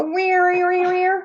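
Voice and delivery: playful high siren whine; high siren wail imitation. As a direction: imitate the siren like a crying kitty. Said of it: "Did the kitty go".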